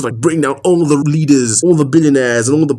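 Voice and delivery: ordinary delivery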